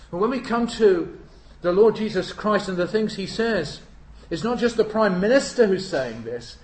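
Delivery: loud, high preacher cry; preaching at full force. Acted. Preacher proclaiming Christ's authority, loud and high.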